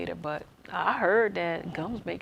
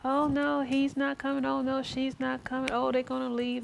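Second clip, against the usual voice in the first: higher, anxious fretting, each "oh no" pitched up; panicky inner voice; 7 semitones higher than usual. Retold or imitated. Voicing her own anxious thoughts while waiting, higher and fretful. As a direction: voice the worried mind spiraling. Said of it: "it's like".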